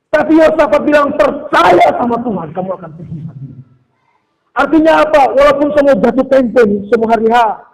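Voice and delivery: high, loud sermon delivery; forceful preaching. Real, not acted. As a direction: drive each fear home to the congregation. (khotbah berapi-api dengan suara tinggi)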